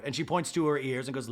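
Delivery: ordinary talk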